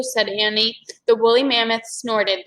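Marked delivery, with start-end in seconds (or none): none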